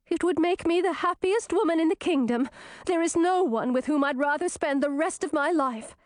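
high, gushing princess; high-voiced, lovestruck declaration; joyful acceptance. A narrator's reading. Princess joyfully accepts marriage proposal.

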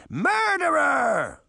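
long, high shriek of accusation; piercing, drawn-out accusing cry; outraged cry. A narrator's reading. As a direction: cry it out high, drawing the word out. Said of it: "she cried".

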